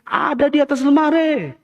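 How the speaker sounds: high, drawn-up answering holler; acted shout back from another room; mother yelling back. Mother answers from the kitchen, yelling back. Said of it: "tapi teriak juga".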